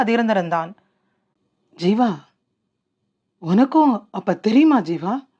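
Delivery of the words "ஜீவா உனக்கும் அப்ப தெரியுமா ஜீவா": mildly breaking voice, halting after the name; a touch of hurt cracking through the question. A narrator's reading. Raghu asks his friend Jeeva if he knew too, voice breaking slightly. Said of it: "ரகுவின் குரல் உடைய".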